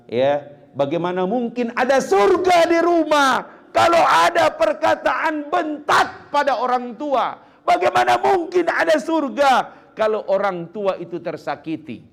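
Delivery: high, loud impassioned cry to the congregation; preaching shout. Real, not acted. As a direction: thunder the question at them, pitch soaring. Preacher's rising rebuke.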